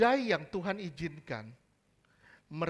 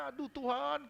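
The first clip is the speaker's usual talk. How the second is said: higher-pitched imitation of a tearful plea; mock wailing; 6 semitones higher, 4 dB quieter than usual. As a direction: lightly mimic crying out to God. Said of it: "nangis sejadi-jadinya".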